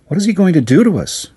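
put-on little rich girl asking, afraid; frightened little girl. A narrator's reading. Caroline voiced by narrator.

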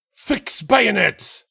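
high, slow battlefield order; shouted command. A narrator's reading. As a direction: draw out the order for the charge. Officer ordering a charge.

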